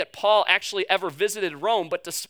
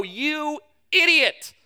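slow, higher exasperated self-scolding; scolding yourself; 4 semitones higher, slower than usual. Retold or imitated. Person scolding themselves in the mirror, slowly exasperated. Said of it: "you look yourself in the mirror and you go".

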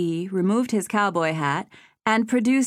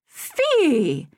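sputtering; 9 semitones higher, 4 dB louder than usual. A narrator's reading. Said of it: "Kelsey sputtered".

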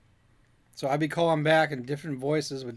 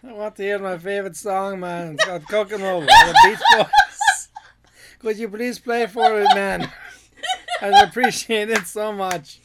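louder, playful Jamaican-style caller voice; mock Jamaican caller; 4 semitones higher, 9 dB louder than usual. Caller begs the DJ for Kokomo, in a hammed-up Jamaican accent. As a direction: lay the Jamaican accent on, laughing. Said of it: "I went that far with it".

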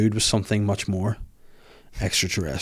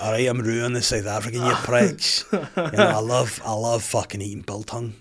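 softly put-on South African accent; 5 dB quieter than usual. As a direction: do a casual South African bloke.

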